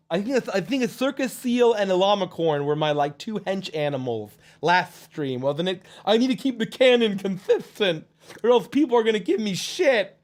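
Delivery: lisping cartoonish voice; goofy character, fretting. Character worries about keeping canon consistent, goofy fretting voice. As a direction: fret comically in a goofy lisp. Continues in Chuckles voice.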